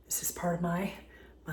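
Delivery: sudden soft whisper. Real, not acted. Host whispers to viewers while showing her mixer. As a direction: drop to a breath of a whisper. Drops voice mid-sentence.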